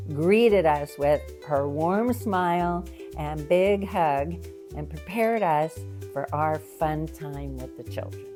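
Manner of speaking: ordinary talk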